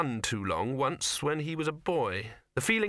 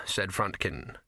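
usual speaking voice

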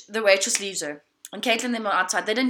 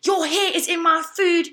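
higher-pitched mock complaint; lightly acted outrage, pitched up; imitated freaking out; 6 semitones higher than usual. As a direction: mimic the upset diner without raising volume. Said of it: "she is freaking out".

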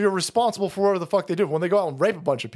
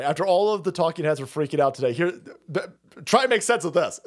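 normal talking voice

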